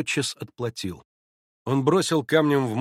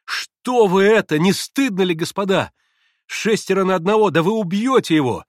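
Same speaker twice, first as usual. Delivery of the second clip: loud, raised-pitch protest; indignant shout; 8 semitones higher, 9 dB louder than usual. A narrator's reading. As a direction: shout to stop the fight. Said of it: "закричал Алёша". (возмущённый крик)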